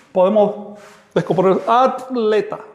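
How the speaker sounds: ordinary delivery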